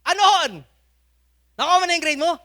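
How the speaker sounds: high, loud, eager father's question; excited father. Retold or imitated. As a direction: ask it like a beaming dad. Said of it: "nakangiti ah, excited". (masayang tatay, mataas at malakas ang boses)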